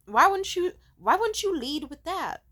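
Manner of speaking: high, loud outraged why; exasperated. Real, not acted. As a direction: demand an answer in disbelief. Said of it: "venting in disbelief".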